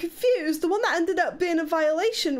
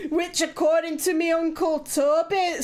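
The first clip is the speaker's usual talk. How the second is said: mock Scottish accent. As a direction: read it like a put-on Scottish storyteller. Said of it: "Do you want me to do it in Leeds accent".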